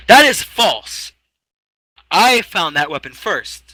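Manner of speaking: high, loud, slow protest; arrogant outburst. Acted. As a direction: blurt it out with arrogance. [name] blurts arrogantly.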